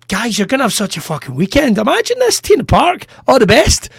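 high, excited festival cheer; upbeat send-off. Retold or imitated. Comic leaving a great gig hypes up the crowd, excitedly. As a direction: gush to the crowd, pitch leaping up.

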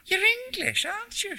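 put-on very high North Country old lady; North Country accent. A narrator's reading. Old lady addressing a stranger on the ferry, high North Country accent. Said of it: "she said in an English North Country accent".

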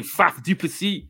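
mock South African accent on the name; lightly put-on South African drawl. Mock South African accent for co-host.

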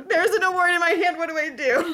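high, loud mock panic with a laugh. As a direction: squeak it out like you're frozen on stage holding the award.

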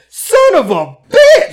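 high, loud, drawn-out mock yell; imitated outburst. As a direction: yell it slowly like a sore loser. Retold outburst of the losing woman.

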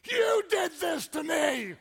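loud, high, drawn-out wail of blame; imitated childbirth shriek; mock labor scream. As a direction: howl the accusation like a woman in labor. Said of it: "She looked at me like".

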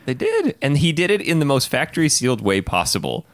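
loud high exclaiming; excited high. Real, not acted. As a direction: burst out excited. Excited indignant outburst.

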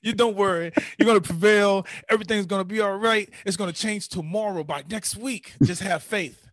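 mock encouragement, easing off at the end; imitated reassurance. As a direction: rattle off comforting clichés. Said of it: "exactly what I wanted to hear".

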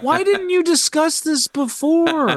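high-pitched, incredulous complaint; exasperated protest. Retold or imitated. Viewer scolding the mom over her marriage, exasperated. Retelling exasperated reaction to film.

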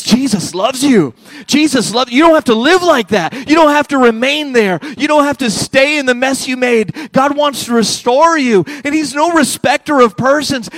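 high, loud preaching climax; full-force preaching. Acted. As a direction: lift it and proclaim it to the room.

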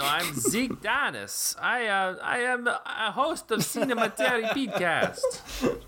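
high puppet voice, slow, breaking into laughter; a Kermit-style voice cracking up; frog host voice. Pretend. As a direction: insist you're someone else in a frog voice. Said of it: "that sounds exactly like Zach Dennis".

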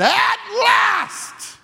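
high, joyful shout of relief; excited shout. Acted. Preacher voicing Adam seeing Eve, ecstatic shout. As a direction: shout it out like a man overjoyed. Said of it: "this is what he said".

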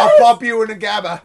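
loud, hammed-up British threat; British accent drifting toward Australian. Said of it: "do your best British accent right now".

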